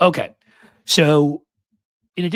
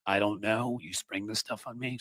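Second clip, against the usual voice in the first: sarcastic echo; 2 semitones higher, 8 dB quieter than usual. Retold or imitated. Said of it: "Sounds a lot like".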